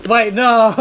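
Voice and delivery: loud high panicked cry; panicked shout. Real, not acted. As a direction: yell in real alarm. Opponent racing to finish.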